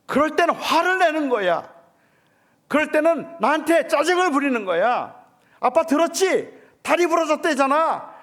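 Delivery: loud, high anguished shouting; retold shout at son. Father shouting at his hurt son in the car, loud and high. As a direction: yell it out, heartbroken and pleading. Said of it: "저도 모르게 그냥 소리를 질렀어요".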